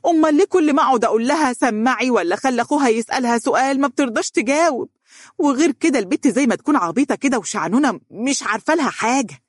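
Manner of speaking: high-pitched, agitated complaint with swooping tune; exasperated outburst. A narrator's reading. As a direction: rant in heated exasperation. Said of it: "بحنق شديد وقالت بانفعال". (بانفعال وحنق شديد)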